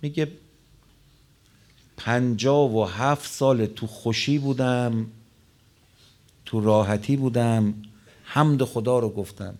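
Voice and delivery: impassioned sermon delivery; full-force preaching. Acted. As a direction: proclaim it to the congregation.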